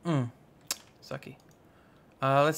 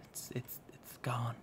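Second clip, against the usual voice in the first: hushed, halting whisper; very soft, breath-only mock whisper; badly done impression; 4 semitones lower, 16 dB quieter than usual. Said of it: "I can't do that impression very well".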